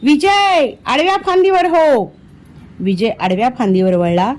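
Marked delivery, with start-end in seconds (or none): 0.0-2.1 high urgent call across the trees. A narrator's reading. Vihari calls to Vijay from another tree: high urgent call. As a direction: call out the instruction to save him. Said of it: "Vihari तेवढ्यात ओरडला".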